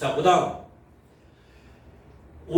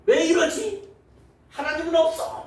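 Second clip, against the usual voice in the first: high, indignant whining at God; mock resentful complaint; 13 semitones higher, 5 dB louder than usual. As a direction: complain to God in hammed-up outrage. Acted despairing unbeliever.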